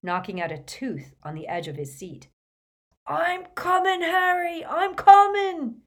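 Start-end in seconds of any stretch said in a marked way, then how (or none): 3.1-5.8 high, loud, stretched-out call; frantic yell. Acted. Hagrid diving to grab Harry, frantic loud yell. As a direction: bellow it as you dive to the rescue.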